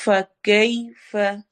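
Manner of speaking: very slow recited word; Quran recitation. Shown as a demonstration. Teacher reciting for students, slow. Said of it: "या लीन".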